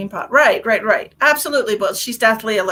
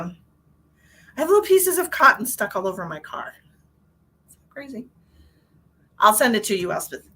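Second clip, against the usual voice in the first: usual speaking voice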